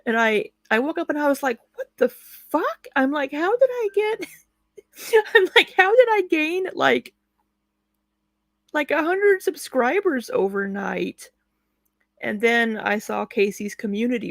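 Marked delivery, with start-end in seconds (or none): 1.8-2.9 high shocked squeal; stunned disbelief. Retold or imitated. Shocked reaction quote, high far. 3.3-11.3 high incredulous voice; baffled and amazed; disbelieving. Retold or imitated. Retelling own disbelief.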